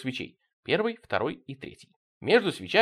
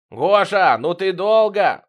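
loud, high, drawn-out shout; impatient hollering; straining yell; 6 semitones higher, 8 dB louder, much slower than usual. A narrator's reading. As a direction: bellow impatiently from afar. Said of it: "надрывался Витёк".

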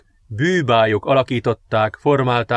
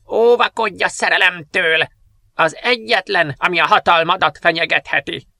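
high eerie witch voice; witch's warning; 6 semitones higher than usual. Acted. Remembered witch warns the princess against love, eerie. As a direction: warn her like an old witch. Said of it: "a boszorkány szavai".

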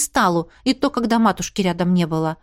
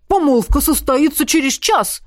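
desperate wail; 4 semitones higher, 3 dB louder than usual. Acted. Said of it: "с отчаянием взвыл Максимилиан".

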